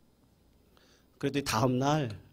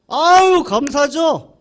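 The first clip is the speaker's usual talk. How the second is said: overjoyed exclamation; 13 semitones higher, 13 dB louder, slower than usual. Retold or imitated. Farmer overjoyed.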